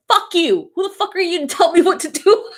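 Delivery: high, mock-indignant yelled retort; mock outraged retort. Retorting to someone giving unwanted advice, high mock outrage. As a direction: snap back in hammed-up outrage. Imagined reply to a know-it-all.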